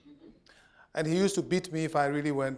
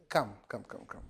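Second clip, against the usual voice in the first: low, soft fatherly "come"; hushed, low repeated call; quiet retold summons; 7 semitones lower, 9 dB quieter than usual. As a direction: beckon softly, voice dropping away. Dad's calm summons, retold.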